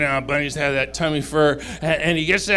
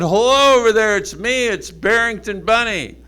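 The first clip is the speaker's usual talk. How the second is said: higher, loud friendly call to someone far off; 7 semitones higher, 7 dB louder than usual. Acted. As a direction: call across the snow, louder and higher. Bunny calls to lost mouse.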